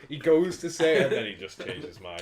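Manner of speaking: laughing. Real, not acted. Laughing at a friend's hesitation.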